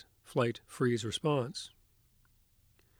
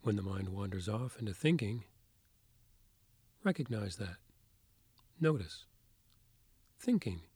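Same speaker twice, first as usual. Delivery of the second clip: soft spa whisper; 4 dB quieter than usual. Acted. Leads a guided meditation.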